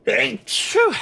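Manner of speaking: loud real sneeze; sudden sneeze; sneezing. Real, not acted. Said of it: "sorry about the sneeze".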